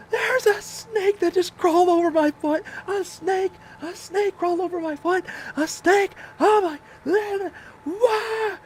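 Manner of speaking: high, loud shrieking freak-out; hammed-up screaming meltdown; hysterical panic. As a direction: wail it in sheer snake terror. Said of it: "And I'm just losing it".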